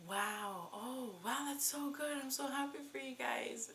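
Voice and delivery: high, breathless whispered joy; excited whisper. Retold or imitated. Sister thrilled at the news.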